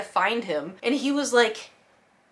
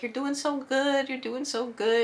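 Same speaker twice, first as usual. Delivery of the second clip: slow soothing praise; 6 semitones higher, slower than usual. Retold or imitated. Praising cat, locking eyes.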